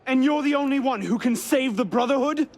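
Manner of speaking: loud, high, incredulous challenge; angry challenge. Acted. Assassin challenges traitor over saving the Brotherhood, angry challenge. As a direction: shout the question as a challenge.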